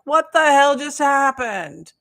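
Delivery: high, loud, slow cry of disbelief; shocked outburst. Retold or imitated. Reacting to the vision of the weeping president, a loud shocked cry. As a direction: yelp it out in alarm. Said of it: "I'm like".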